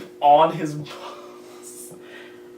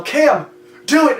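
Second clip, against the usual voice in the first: yelling at a friend; 8 semitones higher, 5 dB louder than usual. Retold or imitated. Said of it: "and I yell".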